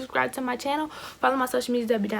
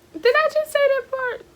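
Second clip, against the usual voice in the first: much higher, panicked self-check; shocked squeal; 4 dB louder than usual. Real, not acted. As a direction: squeak it out in disbelief. Realizes she said too much.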